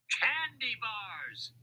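high, drawn-out gleeful cry; shouting. A narrator's reading. Mac interrupts Louis with the truth, shouting. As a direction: call it out high and slow. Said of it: "Mac shouted".

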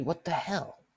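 normal talking voice

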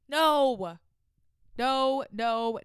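a sharp, loud, high "No.". Real, not acted. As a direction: refuse to believe it. Reacting with disbelief.

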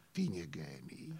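deep quiet echo of the verse; low, soft answer line. Acted. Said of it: "básní".

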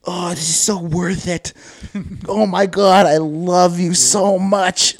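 drawn-out, high and loud; over-the-top lovesick imitation; hammed-up love declaration. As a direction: gush it in mock ecstasy, building. Sick husband declaring love, acted.

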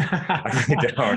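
chuckling confession to a friend; laughing. Real, not acted. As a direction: laugh at yourself while admitting it. Laughs at not knowing day.

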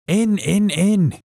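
high, loud eager shout. A narrator's reading. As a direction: scream it, burning with rage and eagerness. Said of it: "he shouted".

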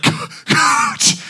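high, strangled squeak; crushed cry. Retold or imitated. Flattened player calling to coach, squashed mock cry. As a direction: squeeze the word out, breathless under a weight. Acts being crushed by opponent.

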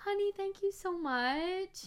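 overwhelmed with gratitude; deeply touched. Real, not acted. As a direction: gasp then high, slow thanks. Touched by surprise ornaments.

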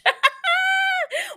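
loud, high-pitched burst of laughter; real laughter. Real, not acted. Laughs at own retold insult.

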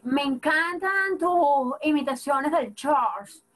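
slowed-down quoting of a fan; slow admiring quote. Retold or imitated. Guest quotes fans praising her impressions, slowly. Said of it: "gente que te dice".

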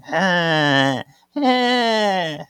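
high, drawn-out "huh" gasps; mock stunned gasps. Host imitating Prue falling to the ground, stunned gasps. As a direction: act out being taken aback with high breaths. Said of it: "She falls to the ground going".

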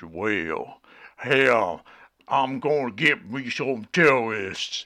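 loud, low, hammed-up Southern American drawl; mock a bad Southern American accent, booming; over-the-top deep Southern American impression. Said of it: "he's got a real deep voice".

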